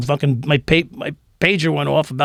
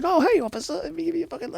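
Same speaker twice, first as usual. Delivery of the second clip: high, soft, sucking-up voice; fake-friendly wheedling; mock fawning guy; 14 semitones higher, 8 dB quieter, faster than usual. Guy fawning at officer, imitated.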